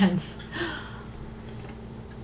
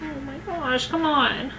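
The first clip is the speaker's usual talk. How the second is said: dismayed; 3 semitones higher, 8 dB quieter, slower than usual. Real, not acted. Sad scene in video.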